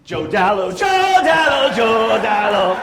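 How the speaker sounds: high, loud, slow drawn-out panicked call; panicked yelling. Retold or imitated. Freaking out on the trail, calling the name in a high slow wail. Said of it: "I'm like ... freaking out".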